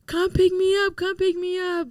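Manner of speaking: hint of the mother's sobbing urgency; mom crying on the phone. Son retelling his crying mother's call, mildly imitated sobbing plea. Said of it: "I just hear her crying".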